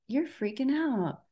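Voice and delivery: calmly name the panic, soft and high; inner observer. Retold or imitated. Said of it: "my little observer was like".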